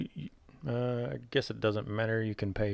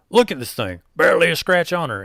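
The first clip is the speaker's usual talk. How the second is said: loud, higher hawking goblin voice; goblin merchant; 8 semitones higher, 9 dB louder than usual. Acted. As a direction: sell it hard like a sleazy goblin trader.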